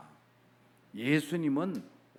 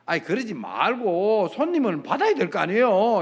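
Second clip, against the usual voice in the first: raised, louder wheedling protest; acted pleading; 5 semitones higher, 7 dB louder than usual. Visitor outside the shaman's door asking entry, pleading protest. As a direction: imitate a visitor coaxing to be let in.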